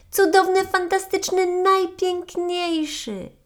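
high, loud, slowing rapture; rapturous marmot. Acted. Marmot gushes over the waterfall to her friend, ecstatic. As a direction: swoon over each word.

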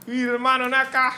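mock Mexican slang. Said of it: "un venezolano que dice".

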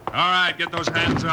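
loud, firm order to raise hands; commanding shout. Acted. Bill ordering the outlaws to raise their hands, loud and commanding.

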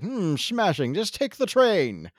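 put-on snooty quote, pitched high and drawn out; mock snobbery. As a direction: play at being a snob, slow and high. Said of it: "I'm starting to sound sneaky".

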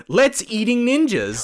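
high, slow mock Engrish line; mock broken English. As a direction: read the bad sign out in a mocking voice.

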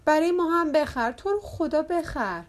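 high, drawn-out begging; childish, insistent plea; pleading child. A narrator's reading. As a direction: beg like a little kid wheedling a parent. Children beg for a hula hoop.

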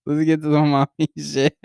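real laugh bursting through the words; breaking into laughter. Real, not acted. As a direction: crack up on the last words. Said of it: "I'm already fucking laughing".

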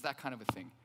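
ordinary delivery

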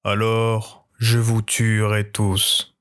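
low, very slow dragon threat. Acted. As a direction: menace them like a ghost dragon. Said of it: "Le dragon répondit".

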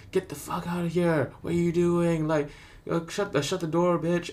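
playful mock rudeness. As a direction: toss the insults at his mum in jest. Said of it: "it was a bit more playful, banterish".

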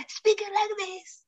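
high, soft, breathless gasping; imitating a child out of breath; out of air. Shown as a demonstration. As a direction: gasp in, then squeeze it out high with no air. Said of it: "I was always out of air".

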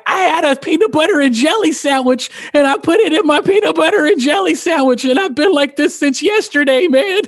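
high, mock-weepy whine; crying friend. Tripping friend explains what he took, mock crying. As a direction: imitate a distraught friend wailing through his explanation. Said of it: "he's crying".

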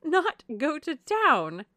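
high, loud, drawn-out amazement; gushy cry of mock shock; laughing outcry. A narrator's reading. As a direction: exclaim in playful disbelief. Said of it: "cried Missus Palmer with a laugh".

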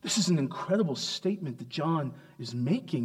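normal talking voice

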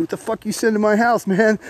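acted angry confrontation. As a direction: confront him, retold with some heat.

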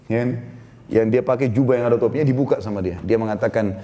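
ordinary talk, nothing marked

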